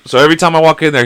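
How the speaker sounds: usual speaking voice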